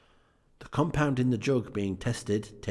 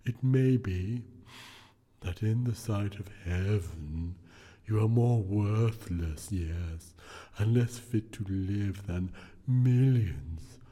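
unearthly voice; slower than usual. Acted. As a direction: intone it like a solemn spirit. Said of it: "said the ghost".

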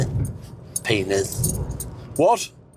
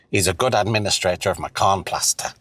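normal talking voice

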